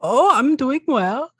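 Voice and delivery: put-on high-pitched elderly dragonborn with a European accent; old dragonborn man. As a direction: greet them as a high-voiced old uncle. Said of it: "and the old man just goes".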